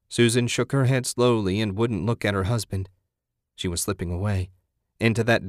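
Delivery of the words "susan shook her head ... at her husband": ordinary delivery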